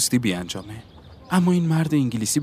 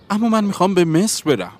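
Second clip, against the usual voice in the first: high, loud objection; protesting; 11 semitones higher, 5 dB louder than usual. A narrator's reading. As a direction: object with the voice pitched up. Boy objects to caravan's destination.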